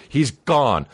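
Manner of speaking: ordinary talk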